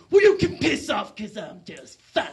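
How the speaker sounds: loud, slow dismissal from a grumpy uncle; grouchy acted outburst; irritated brush-off. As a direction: tell him off loudly, dragging it out. Irritated uncle dismisses his nephew.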